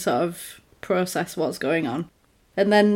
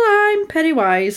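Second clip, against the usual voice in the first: creepy clown voice; 8 semitones higher, 3 dB louder than usual. Retold or imitated. Voicing Pennywise.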